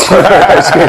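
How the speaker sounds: ordinary talk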